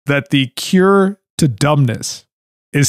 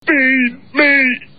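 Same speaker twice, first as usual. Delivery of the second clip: put-on high petulant child yell; whiny demanding kid; 10 semitones higher than usual. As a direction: demand food like a spoiled kid. Voicing the greedy son.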